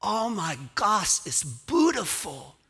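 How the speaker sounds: put-on high, slow, loud child's voice full of wonder; breathless little daughter calling to her daddy; awed little girl. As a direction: sound like a tiny girl amazed by the sky. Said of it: "you hear little Iris looking up, going".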